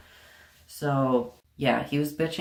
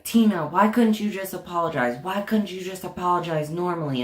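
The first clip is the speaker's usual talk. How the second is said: raised, exasperated scolding; principal flipping out; 5 semitones higher than usual. Retold or imitated. Principal scolding the student for her apology, exasperated and raised. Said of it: "he starts flipping out on me".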